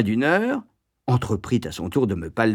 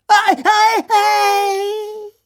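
very high, loud shrieks of pain; howling in pain; 7 dB louder, much slower than usual. A narrator's reading. Boy faking agony as matron presses his belly, howling. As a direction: yell ow as if poked in a sore belly. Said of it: "hurlai-je".

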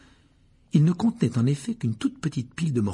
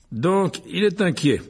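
higher, fiercely satisfied voice; fierce satisfaction; 8 semitones higher, 4 dB louder, slower than usual. A narrator's reading. Mr Weasley learns Malfoy was selling, fierce satisfaction. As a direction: relish the deduction. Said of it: "avec une satisfaction féroce".

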